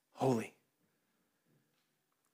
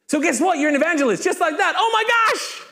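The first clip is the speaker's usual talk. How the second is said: high-pitched, squealing excitement; mock amazement; 10 semitones higher than usual. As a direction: gush in hammed-up astonishment. Mock amazement at becoming evangelist.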